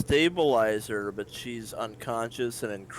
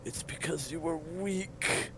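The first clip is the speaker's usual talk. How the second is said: accusing master; 6 semitones higher, 7 dB quieter than usual. Acted.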